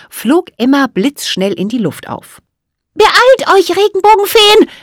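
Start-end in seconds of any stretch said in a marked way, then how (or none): 2.9-4.7 high, loud fairy voice; little fairy rallying her sisters; urgent call. A narrator's reading. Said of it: "rief sie".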